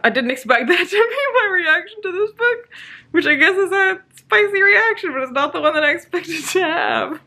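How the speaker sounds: giggling at her own spicy reaction; laughing. Real, not acted. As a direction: laugh it out, amused at yourself.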